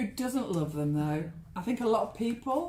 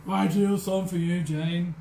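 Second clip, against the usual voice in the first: mock low voice; 6 semitones lower than usual. Repeats in low voice for Jane.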